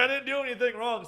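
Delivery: high, loud mock indignation; defensive protest. Bartender defends himself to his bosses, mock indignant. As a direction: protest your innocence loudly. Teller protests innocence to boss.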